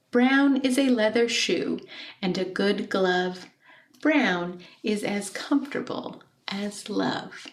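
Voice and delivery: gently expressive poem recital; poem reading. Acted. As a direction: read the poem to the class, savouring each line. Teacher reads poem aloud.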